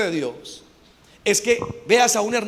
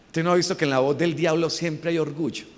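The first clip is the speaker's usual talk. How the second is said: ordinary talk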